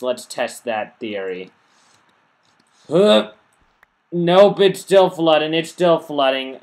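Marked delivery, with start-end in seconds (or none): 2.9-4.6 sharp, much higher and louder huh; surprised huh. Real, not acted. Gamer watches his water test fail, startled louder huh. 4.6-5.5 louder, raised it's still flooding; louder exasperated. Real, not acted. Gamer sees the water still flooding his farm, louder disbelief. Exclaims test failed.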